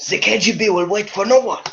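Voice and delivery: high-pitched mock German accent. Streamer reprising the KGB joke, put-on German accent. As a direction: deliver it as a comic German-accented threat. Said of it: "I'm doing a German accent".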